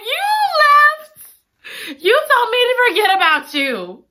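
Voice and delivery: high, wounded cry of accusation; hurt lover. Retold or imitated. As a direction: throw it at her like a wound. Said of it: "Ellie was like".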